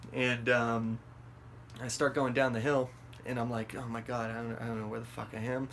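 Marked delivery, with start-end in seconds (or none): 3.8-5.7 hushed, quick self-talk; lost muttering. Retold or imitated. Going down the hill lost, worried muttering. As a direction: act out worrying under your breath.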